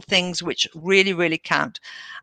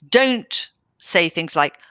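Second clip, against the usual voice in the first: ordinary delivery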